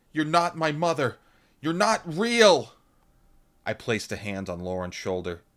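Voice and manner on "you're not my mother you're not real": high, loud accusing cry; distraught loud girl; tearful accusation. A narrator's reading. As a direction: deny her to her face. Lauren shouts at mother.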